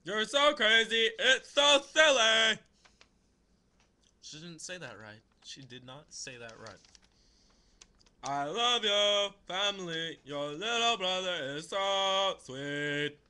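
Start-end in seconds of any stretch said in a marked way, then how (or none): 0.0-2.6 loud, high, drawn-out mock love letter; hammed-up, half-sung letter reading; funny sing-song reading. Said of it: "I read them a funny way". 8.2-13.2 loud, slow, drawn-out recital; hammed-up sing-song love letter; funny letter reading. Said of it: "I read them a funny way".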